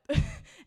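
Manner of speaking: short high squeal of a laugh; tickled by her own joke; real laugh. Real, not acted. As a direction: burst out in a quick high giggle.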